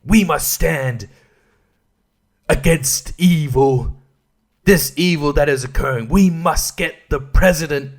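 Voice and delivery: slow, portentous mock speech; overblown theatrics. Film character rallying against evil on the plane, theatrical. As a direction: declaim it haltingly, with grand dramatic pauses. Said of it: "too much of the theatrics".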